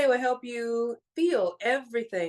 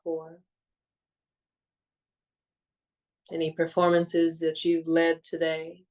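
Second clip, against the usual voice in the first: measured, soothing meditation voice with long pauses; guided meditation; 4 semitones lower than usual. Real, not acted. Guiding listeners to let go of the day, calm meditation voice. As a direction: ease listeners gently into stillness, phrase by phrase.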